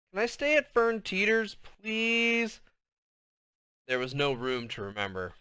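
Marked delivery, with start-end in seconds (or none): 0.1-2.6 lightly voiced pleading kid, higher; begging. A narrator's reading. Little girl begging her mother to sleep over, pleading. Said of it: "Tallahassee begging".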